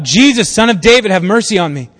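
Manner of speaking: read-aloud cry across a crowd; calling out. A narrator's reading. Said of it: "He called out".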